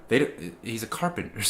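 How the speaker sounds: ordinary talk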